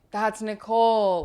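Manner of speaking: slow, loud call across the site; called-out correction. Retold or imitated. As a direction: call it out so he hears. Workers calling out correction.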